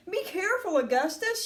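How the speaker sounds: high-pitched call of caution; a mild warning shout. A narrator's reading. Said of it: "shouted Mister Gloop".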